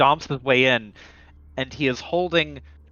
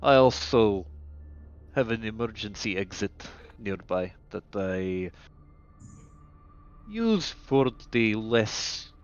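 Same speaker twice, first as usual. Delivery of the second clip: low, quiet doctor voice, halting between phrases; weary underground doctor; 3 semitones lower, 7 dB quieter than usual. Acted. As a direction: speak low and deliberately, resigned.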